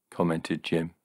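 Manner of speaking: ordinary delivery, nothing marked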